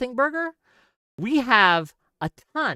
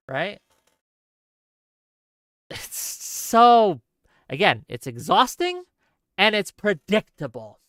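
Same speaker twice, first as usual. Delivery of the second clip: ordinary talk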